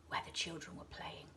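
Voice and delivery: ordinary delivery, nothing marked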